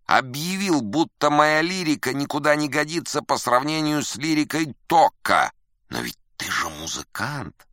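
high, loud, theatrical indignation; outraged rant. Acted. Musician telling visitors what the critic wrote, outraged. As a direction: rail against an insult to your genius.

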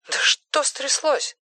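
very high, soft, drawn-out question; alarmed phone voice. Acted. Simone asks Erik what happened, high alarmed phone voice. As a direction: voice leaps up high through the telephone. Симона встревожена, по телефону.

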